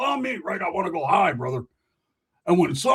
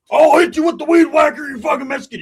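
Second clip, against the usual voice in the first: loud, higher threat shout; angry threat; 10 semitones higher, 9 dB louder than usual. Acted. Roach swinging the weed whacker at them, shouting. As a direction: yell while swinging. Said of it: "Roach got up swinging".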